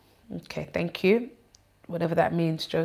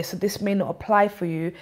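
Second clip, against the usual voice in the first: usual speaking voice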